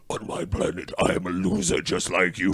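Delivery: low monster voice; deep creature impression; imitated movie monster. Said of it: "that was really weird. Was like".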